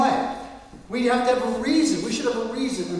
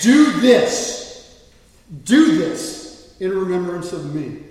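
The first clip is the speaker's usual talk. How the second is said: loud, emphatic order; forceful command; 8 dB louder, much slower than usual. Acted. Jesus commanding his followers to remember him, forceful command. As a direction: command it with weight, drawn out. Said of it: "is a command. He's literally commanding it".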